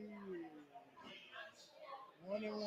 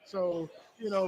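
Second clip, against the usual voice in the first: normal talking voice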